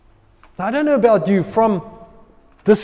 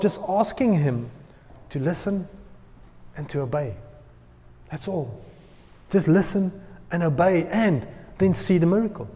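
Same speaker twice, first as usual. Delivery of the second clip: ordinary delivery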